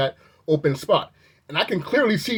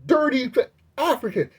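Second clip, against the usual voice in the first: retold yell; 8 semitones higher than usual. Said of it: "he's yelling at him".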